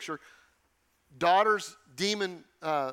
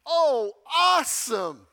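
high, slow, loud gushing at a trial; overjoyed believer, played up; mock delight; 6 semitones higher, 7 dB louder, slower than usual. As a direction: ham up cheerful delight over a trial. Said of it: "I'm not there yet".

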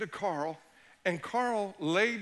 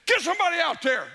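sideline yell; 8 semitones higher, 5 dB louder than usual. Retold or imitated. Coach yelling at assistant on sideline.

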